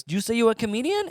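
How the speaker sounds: put-on high-pitched question in rough Hawaiian accent; attempted Hawaiian accent. Comic imitating a stranger who approached him, attempted Hawaiian accent. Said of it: "he's speaking in a Hawaiian accent".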